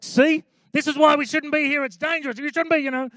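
high-pitched mock protest; alarmed husband. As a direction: play a panicky husband saying I told you so.

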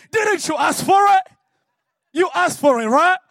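loud, high, playful teasing reply; teasing Jesus. Retold or imitated. Jesus laughingly reminding the crying man he asked for it. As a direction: tease him with a laugh in it.

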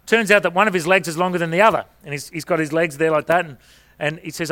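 ordinary talk, nothing marked

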